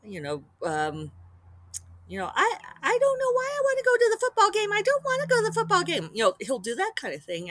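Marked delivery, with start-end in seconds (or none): 2.4-6.1 much higher, fast whiny parody of mom; squeaky mock-whine of a wife; mocking high-pitched parody. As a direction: stepdad teasing mom in high falsetto. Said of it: "pitch his voice very high and to imitate her in an obvious parody".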